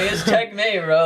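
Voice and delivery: smug triumph. Retold or imitated. Teen gloating inwardly after winning against parents, high smug crow. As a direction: crow it like you just won the game.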